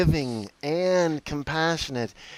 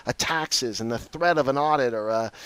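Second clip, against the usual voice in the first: ordinary delivery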